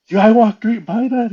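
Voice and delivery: high, loud acted disbelief; retold alarmed realization. Storyteller voices man who passed the creature, alarmed disbelief. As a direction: blurt it out as if just realizing.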